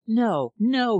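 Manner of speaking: high, loud refusal; crying out no. Acted. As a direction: burst out, pulling away. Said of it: "she cried".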